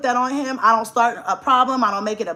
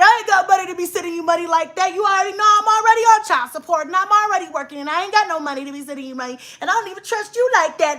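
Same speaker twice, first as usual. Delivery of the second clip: fast, raised mock rant about child support; mimicking ex; 7 semitones higher, faster than usual. Retelling the ex's rant about money, fast mock anger. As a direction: fire it off like the ex, indignant and quick.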